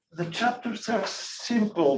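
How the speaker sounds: usual speaking voice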